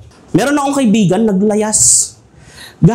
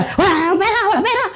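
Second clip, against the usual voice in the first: higher, mock whining wife; complaining wife; 13 semitones higher, faster than usual. Preacher imitating a wife whining there is no money, mock whine.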